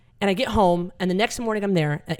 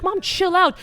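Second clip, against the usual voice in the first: exasperated; 13 semitones higher, slower than usual. Retold or imitated. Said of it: "I'm like".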